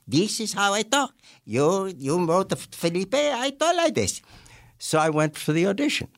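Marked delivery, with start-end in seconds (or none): 0.1-4.2 Felipe. Acted. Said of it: "how else am I going to talk?".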